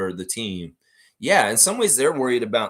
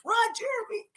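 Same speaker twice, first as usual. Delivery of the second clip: much higher falsetto voice, comic; silly falsetto greeting. Retold or imitated. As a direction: squeak it like a cartoon. Mimicking greeting to Jack.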